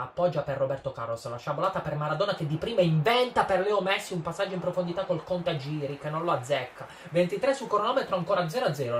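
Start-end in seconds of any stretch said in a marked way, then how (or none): 1.3-9.0 high, loud rush of play-by-play; excited commentary. Real, not acted. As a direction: ride the attack, voice climbing. Big chance in play-by-play.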